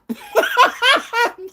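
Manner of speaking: bursting high-pitched laughter; a short, very high cackle. Real, not acted. Eater laughing at own hot wings, high cackle.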